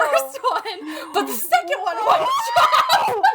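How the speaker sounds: reading through uncontrollable laughs; laughing. Real, not acted. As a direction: voice climbing into laughter. Reading while laughing hard.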